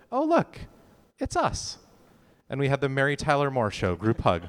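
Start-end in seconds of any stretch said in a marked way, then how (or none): 0.1-1.8 high-pitched quoted voice; imitating Carolyn. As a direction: voice a castmate's remark up high, with a pause midway. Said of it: "And Carolyn just said".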